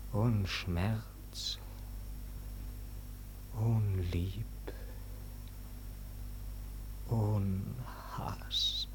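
slow, hollow murmur fading to nothing; fading into whisper. Acted. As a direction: let each word sink into emptiness. Claudio sinking into emptiness.

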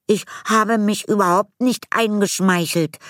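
put-on high, indignant girl's protest. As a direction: protest in outrage. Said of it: "rief Julia empört". (empört gerufen)

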